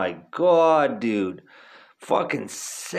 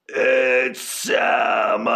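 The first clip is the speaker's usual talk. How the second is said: long, drawn-out shout out a car window; teasing drawn-out yell; retold yell; 3 semitones lower, 3 dB louder, much slower than usual. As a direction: stretch it out and holler it at passers-by. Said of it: "we'd roll down the window and yell".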